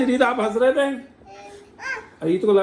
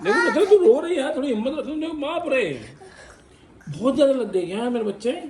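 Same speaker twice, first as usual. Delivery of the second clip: laughing teasing; 7 semitones higher than usual. Real, not acted. Teasing crying wife, laughing.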